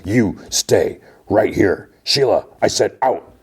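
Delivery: angry order. Retold or imitated. Mayor orders two aides, acted.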